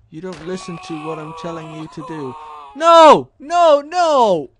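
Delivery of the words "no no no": loud, high cries of dismay; frustrated shout. Real, not acted. Gamer yelling at a failed move, frustrated shout. Avatar fails in game.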